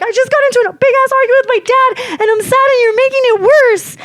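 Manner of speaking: high-pitched, distressed wail; retold screaming and crying. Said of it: "I just started crying".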